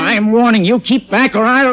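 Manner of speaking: high, loud cornered threat; the exposed killer threatens the officer; desperate threat. Acted.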